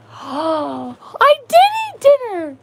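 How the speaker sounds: high, slow gasping realization; sudden comic remembering; surprised gasp. Real, not acted. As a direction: gasp then blurt it out. Gasps realizing she ate.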